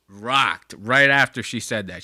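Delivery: ordinary talk, nothing marked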